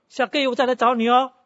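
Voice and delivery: cheery promise. Retold or imitated. Woman pastor cheerfully tells the old man she'll return, high and loud. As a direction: sing it out like a friend who won't give up.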